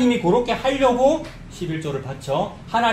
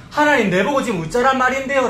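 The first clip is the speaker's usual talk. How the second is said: higher-pitched frustrated complaint; exasperated; 6 semitones higher than usual. Acted. Preacher voicing the people complaining to God, exasperated. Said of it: "어떤 때는 짜증도 나고".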